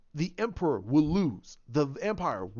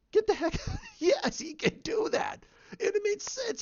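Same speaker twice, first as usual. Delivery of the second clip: excited laugh. Real, not acted. Laughing, emphatic defense of Vader.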